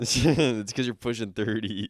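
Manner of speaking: slow, laughing jab about age; laughing tease. Real, not acted. Teasing her friend, laughing slowly through the jab. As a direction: chuckle through the teasing line.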